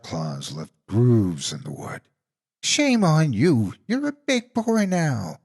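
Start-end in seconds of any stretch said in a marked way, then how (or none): 2.6-5.4 put-on much higher, slow scolding old-lady voice; mock scolding auntie. A narrator's reading. Monster disguised as auntie scolding Shaky, high and slow. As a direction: scold like a fake granny.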